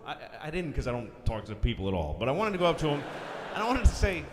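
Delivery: normal talking voice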